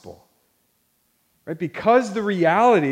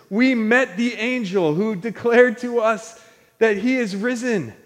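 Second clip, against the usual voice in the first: raised, impassioned preaching voice; apostles preaching; 5 semitones higher, 4 dB louder than usual. Acted. Apostles telling the church what they saw, impassioned preaching. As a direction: proclaim it high and forcefully, like an eyewitness to the church. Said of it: "they keep preaching to this church".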